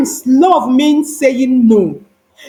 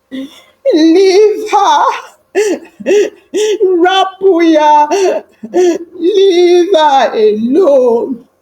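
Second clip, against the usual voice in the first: crying plea; 6 semitones higher, much slower than usual. A narrator's reading. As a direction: beg through sobs to leave her alone. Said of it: "Zach whispering through his tears".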